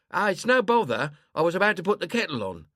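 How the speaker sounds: brushing off the refusal, fussing; chatty old woman, insistent. Acted.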